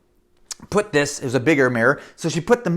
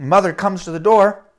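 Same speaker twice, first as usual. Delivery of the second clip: usual speaking voice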